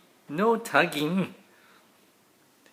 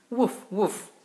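a higher, lightly voiced woof woof; playful puppy bark; 7 semitones higher than usual. Dad reading to his kids, lightly barking as the puppy.

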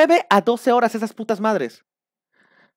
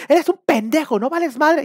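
raised, louder mock insult; mock group yelling; 9 semitones higher, 4 dB louder than usual. Crowd yelling insults at the attendee, mock shout. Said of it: "te están gritando".

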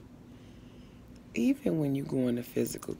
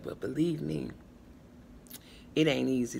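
ordinary delivery

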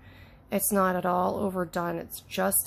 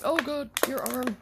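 ordinary talk

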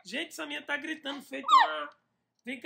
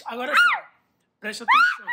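urgent shout; 7 dB louder than usual. Real, not acted. Urgent instruction during game.

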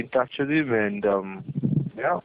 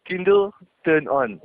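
slower; 6 semitones higher, 5 dB louder, slower than usual. Acted. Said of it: "but slower".

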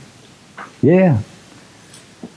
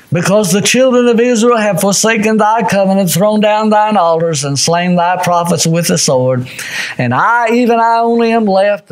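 raised, high-pitched preacher's recitation; impassioned scripture reading; 7 semitones higher than usual. Real, not acted. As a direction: proclaim the prophet's complaint. Reads Elijah's complaint in preaching voice.

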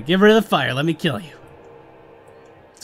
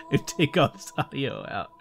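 laughing through the words, softer; 7 dB quieter than usual. Real, not acted. Gamer joking about editing out his failures, laughing. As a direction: chuckle it out at yourself.

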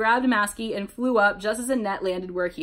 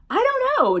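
very high, loud shout; yelled; 6 dB louder than usual. A narrator's reading. Jeff answering mid-attack, high yell. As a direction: shout it back at the top of the range. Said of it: "Jeff yelled".

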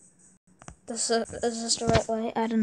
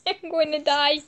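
laughing in panic, higher; panicked laughing; 4 semitones higher, 5 dB louder than usual. Real, not acted. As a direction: laugh through the fear. Laughing panic while being chased.

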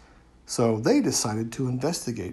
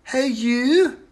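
high, loud call; shouted; 12 semitones higher, 7 dB louder than usual. A narrator's reading. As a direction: call out to the troll. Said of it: "shouted Ren".

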